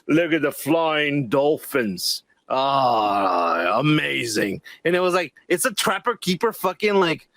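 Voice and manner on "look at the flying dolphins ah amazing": slow, low gushing wonder; mock awe. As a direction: gush over it sarcastically. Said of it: "I was like".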